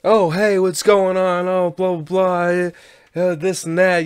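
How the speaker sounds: breezy Southern hello, all good cheer; chatty carefree local at the desk; cheery Southerner. Retold or imitated. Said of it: "cheerful Southerner voiced".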